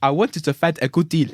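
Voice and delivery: loud put-on African accent; African-accented advert customer; mock African accent. Voicing insurance ad customer, loud African accent. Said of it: "like an African person".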